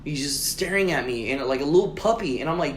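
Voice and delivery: usual speaking voice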